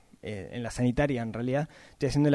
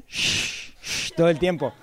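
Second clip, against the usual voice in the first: imitated beard rustle; slower than usual. Podcaster imitating his beard rubbing the microphone, hissed shh. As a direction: hiss out the scratchy noise the beard made. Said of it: "el ruido era shh".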